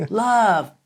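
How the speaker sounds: loud, high, hopeful blurt; eager guess. Retold or imitated. Pupil guessing the mentor's answer, acted eagerness. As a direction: call out an answer like a keen pupil.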